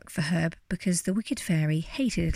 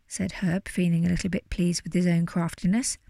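ordinary delivery

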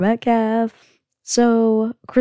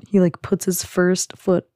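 usual speaking voice

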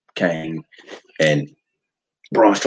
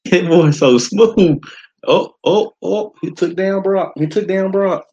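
excited; 10 semitones higher, slower than usual. Real, not acted. Hyped watching the big move.

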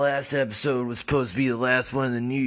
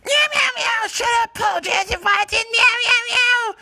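very high, loud cat screech; high loud catlike meowing; shrieking cat voice; 6 dB louder than usual. Acted. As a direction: yowl like a deranged cat doctor. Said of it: "said Doctor Kitties".

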